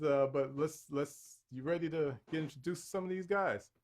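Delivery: ordinary talk, nothing marked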